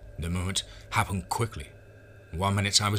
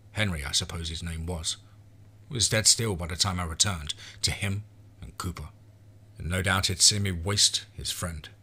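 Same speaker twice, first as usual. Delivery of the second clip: ordinary delivery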